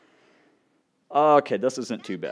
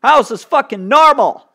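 outraged disbelief; 13 dB louder than usual. Real, not acted. Outraged at hard level.

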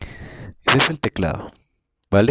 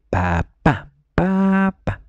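put-on coaxing a child to say papa; 10 semitones higher, much slower than usual.

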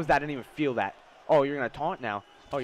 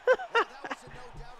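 high laugh after a win; gloating laugh. Real, not acted. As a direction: burst out laughing, high-pitched.

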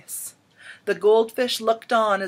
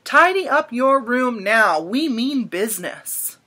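slow, firm command from the cockroaches, louder on the first words; stern cockroach chorus; 2 semitones higher, 4 dB louder than usual. A narrator's reading. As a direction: lay down the law like a swarm. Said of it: "the cockroaches replied".

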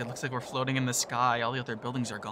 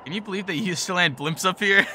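louder, higher, buzzing with the rush; thrilled; 5 semitones higher, 10 dB louder than usual. Acted. Mark gushes to his friend about the view, loud and thrilled. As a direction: shout your delight into the wind.

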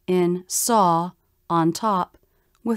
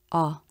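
"ah" short, lower and flat; unstressed AH; 5 semitones lower, 4 dB quieter than usual. Shown as a demonstration. Teacher showing an unstressed syllable, short low flat "ah". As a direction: toss off "ah" low, with no stress. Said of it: "the voice will be lower in pitch".

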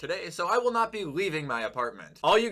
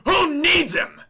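high, loud mock-innocent squeal; 13 semitones higher, 9 dB louder, slower than usual. Real, not acted. Gamer mocking the receiver he benched, in a high, loud squeal. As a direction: toss it off in a high, cheeky squeal.

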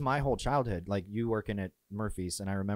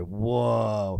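put-on loud, wowed kid reaction; retold wide-eyed wonder; awestruck whoa; 6 dB louder than usual. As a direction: relive a child's amazement. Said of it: "I just remember thinking".

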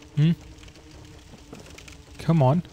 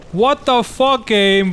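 higher, louder yell at the game; angry outburst; 5 semitones higher, 5 dB louder than usual. Real, not acted. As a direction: shout the frustration at the screen. Raging at a game bug.

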